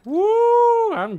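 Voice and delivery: very high, loud whoop; excited whoop. Real, not acted. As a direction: let out a victory whoop. Celebrating a kill.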